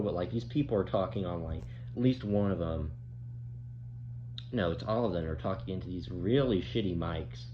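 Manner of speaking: usual speaking voice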